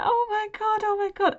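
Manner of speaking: panic. Retold or imitated. Panicking in the interview.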